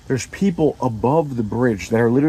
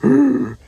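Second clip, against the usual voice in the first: sharp high gasp for air; a high, loud gasp for air; 13 semitones higher, 4 dB louder than usual. Retold or imitated. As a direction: suck in a sudden loud breath. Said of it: "I'm gasping for air".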